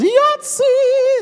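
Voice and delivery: high, loud, drawn-out whoop; gleeful high shout; excited yell. Retold or imitated. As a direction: belt it out like a jackpot win. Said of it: "all I heard was".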